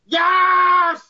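long, high re-enacted scream of triumph; retold ecstatic fan screaming. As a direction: stretch each YES into a loud, high victory scream. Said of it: "and I'm just screaming".

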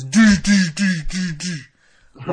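loud first note, then easing off; sung-out mock piano notes; imitated piano plinking. Recapping Strowman sitting at the piano, imitated plinking.

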